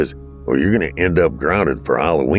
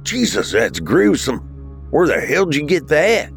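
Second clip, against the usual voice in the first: startled, voice climbing at the end; startled reaction; 9 semitones higher than usual. Acted. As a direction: recoil at a gruesome sight. Brother startled by gruesome mask.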